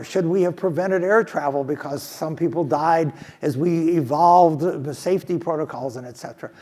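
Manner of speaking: ordinary talk, nothing marked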